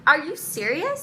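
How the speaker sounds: high, loud indignant demand; retold outraged retort; incredulous. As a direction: demand it in disbelief.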